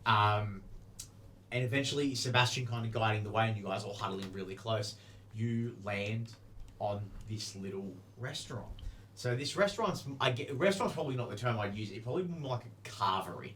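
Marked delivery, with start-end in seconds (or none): none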